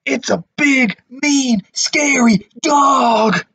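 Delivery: high, scared, slow on scary dog; frightened burglar. Acted. Frightened burglar describing the dog, high scared voice. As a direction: sound scared of the big dog. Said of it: "said one of the burglars".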